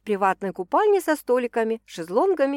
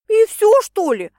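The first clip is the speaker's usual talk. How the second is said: outraged cry, much higher and louder; indignant; 13 semitones higher, 10 dB louder than usual. A narrator's reading. Friend outraged at a too-short answer, indignant. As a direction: protest that she's told too little. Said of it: "возмутилась Янка".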